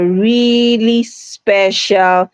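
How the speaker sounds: REALLY SPECIAL drawn out slowly; emphasis on really special. Shown as a demonstration. Host reading out a viewer's comment, emphasis on "really special". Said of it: "I like the emphasis".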